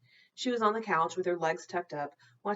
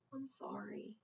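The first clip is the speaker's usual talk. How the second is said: soft apology; 4 semitones higher, 15 dB quieter than usual. Acted. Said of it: "Virgil said, staring into Gulliver's eyes".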